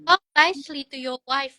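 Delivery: put-on a small boy firmly defending his mom; son scolding dad. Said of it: "dia sampai kayak gitu". (anak menegur papanya dengan suara anak yang lebih tinggi dan keras)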